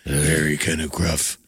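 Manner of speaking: low, slow gruff voice; gruff Batman. Acted. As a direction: growl it slowly like a gruff Batman. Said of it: "have to talk like that".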